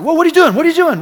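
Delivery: high, loud startled cry; Isaac panics on the altar; alarmed boy. Retold or imitated.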